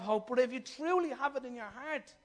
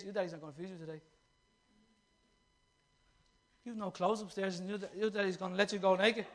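a hint of a sly neighbour, low and soft; lightly voiced taunting neighbour; mocking neighbour; 4 semitones lower, 4 dB quieter than usual. Preacher voicing a neighbour taunting kids, lightly mocking. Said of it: "my neighbour ... saying to my kids".

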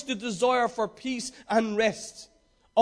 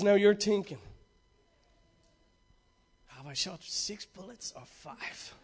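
squint and growl it low like Clint; Clint Eastwood impression; 3 semitones lower, 6 dB quieter than usual. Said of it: "I used to be able to do his accent".

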